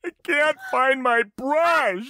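put-on high, slow whiny teen girl; mock dramatic daughter. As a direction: ham up a teen in distress. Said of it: "it's so dramatic".

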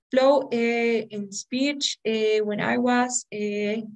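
imitating a student searching for words; halting, with drawn-out "eh" fillers; hesitant filler-laden speech. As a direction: stall on each "eh" as if thinking. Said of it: "some students tend to use this eh a lot".

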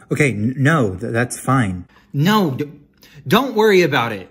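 loud, stammering, rising-pitched retreat; flustered brush-off. Acted. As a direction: hastily insist it is fine, voice climbing. Brushes off wife's refusal, flustered.

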